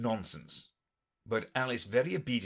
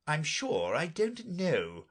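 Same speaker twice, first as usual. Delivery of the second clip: ordinary delivery, nothing marked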